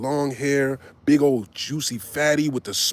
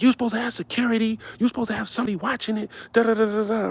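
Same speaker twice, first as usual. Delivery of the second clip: fast, high mock complaint from the upset girls; girls going off; 8 semitones higher, faster than usual. Upset dancers scolding Brick over the stolen bag, fast and high. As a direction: rattle off the complaint in a high, quick voice. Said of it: "they blacking on Brick".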